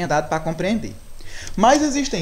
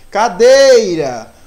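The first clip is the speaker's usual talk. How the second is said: "Cadeira" slow, with "dei" much louder and stretched; stress on middle syllable; 4 semitones higher, 12 dB louder, much slower than usual. Shown as a demonstration. Teacher asking pupils which syllable stands out, "dei" heavily stressed in "Cadeira". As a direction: blast and hold the "dei" in "Cadeira". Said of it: "quem se destaca mais? Justamente o dei".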